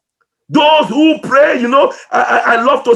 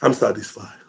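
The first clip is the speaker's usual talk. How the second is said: hushed repeat; 13 semitones lower, 8 dB quieter than usual. Real, not acted. Repeats in a near-whisper.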